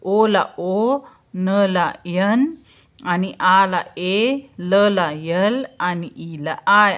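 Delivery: slow, each letter pair spaced out; slow letter mapping. Shown as a demonstration. Teacher dictating the spelling of Sonali to children, slowly. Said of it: "अशा प्रकारे".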